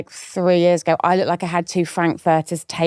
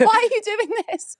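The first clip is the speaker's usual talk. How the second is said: laughing mock wail; 13 semitones higher than usual. Co-host plays the kid.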